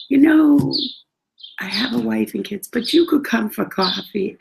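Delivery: imitated driver making his pitch; cab driver's invitation. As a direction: raise the voice and coax.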